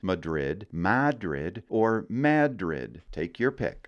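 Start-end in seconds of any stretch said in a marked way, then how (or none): 0.0-0.7 Madrid with a schwa, muh-DRID, rising; first local Madrid; Maine Madrid, first way. Shown as a demonstration. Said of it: "three different ways". 0.7-1.6 Madrid with a flat a, MAD-rid, rising; second local Madrid; Maine Madrid, second way. Shown as a demonstration. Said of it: "three different ways". 2.1-3.0 Madrid drawn out slowly, MAD-rid with a flat a; Maine Madrid, third way. Shown as a demonstration. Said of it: "three different ways".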